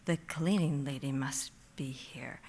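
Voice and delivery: soft unvoiced whisper to herself; whispered reasoning. Seeing cleaning supplies, she whispers a guess to herself. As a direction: whisper it, calming yourself down. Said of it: "And I was like".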